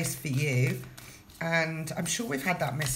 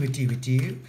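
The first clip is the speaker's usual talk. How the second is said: mock echo; 5 semitones lower than usual. Mock echo after "without further ado.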